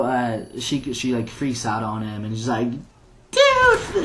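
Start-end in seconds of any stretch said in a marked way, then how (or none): 3.3-4.0 very high, loud outraged squeal; high-pitched mock-appalled yelp; imitated freak-out. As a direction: shriek it in mock horror. Lady freaks out after being hit.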